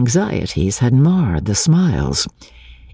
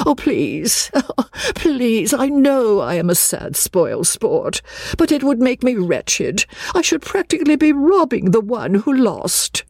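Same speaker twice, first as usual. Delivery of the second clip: pleading; 10 semitones higher than usual. Acted. Charlotte pleading, a spoilsport.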